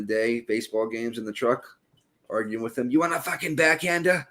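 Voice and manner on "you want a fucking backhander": angry Boston dad. Retold or imitated. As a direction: bark it like a furious old-school father. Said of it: "My dad, if you ever fucking heard him".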